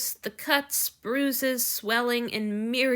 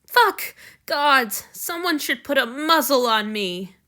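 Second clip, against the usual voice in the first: loud, high cry at himself; horrified outburst; 4 semitones higher, 7 dB louder than usual. Acted. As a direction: curse your own big mouth. Hanyu curses his own blunder.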